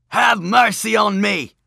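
high, loud desperate plea; pleading madman. A narrator's reading. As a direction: beg loudly for mercy. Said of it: "said the madman".

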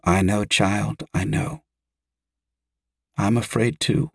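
soft, hushed motherly reassurance; tender comforting. A narrator's reading. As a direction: soothe a frightened child very gently. Mother comforts her frightened child.

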